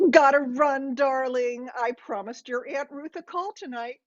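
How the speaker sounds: high, singsong mom signing off; breezy mother. A narrator's reading.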